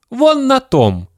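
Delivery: put-on very high, timid child voice; small boy's reply. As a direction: answer like a little boy. Scared boy points out the ledge.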